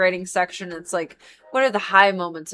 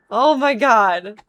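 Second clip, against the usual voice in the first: drawn-out exasperation; 3 semitones higher, 6 dB louder, much slower than usual. Real, not acted.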